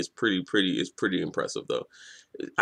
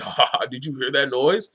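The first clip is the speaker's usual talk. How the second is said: higher-pitched gleeful jeer, imitating a bully; mocking; 5 semitones higher than usual. Villain making fun of the noise they make, mocking. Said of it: "he's mocking it".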